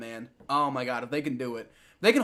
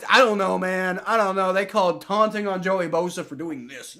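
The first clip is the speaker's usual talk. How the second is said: usual speaking voice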